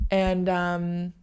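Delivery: ordinary talk, nothing marked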